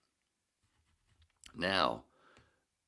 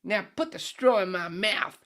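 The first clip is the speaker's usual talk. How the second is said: high, loud Aussie bloke's voice with a drift toward British; Australian accent; 16 dB louder than usual. Acted. Head orders a beer from the bartender, loud high Australian accent. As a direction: belt it out as a brash Australian drinker, way up high. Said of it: "Australian accent keep going into British".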